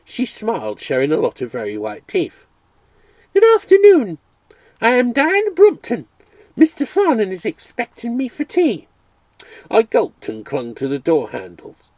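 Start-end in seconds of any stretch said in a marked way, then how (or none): 3.3-8.9 high, loud refined lady's voice; grand society girl announcing herself; high, louder haughty young woman. Acted. Posh young lady introduces herself.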